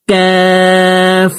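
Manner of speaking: কাফ held slow; drawn-out letter. Shown as a demonstration. Teacher models the letter, slow. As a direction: stretch কাফ out. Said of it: "উচ্চারণ করে পড়তে হবে".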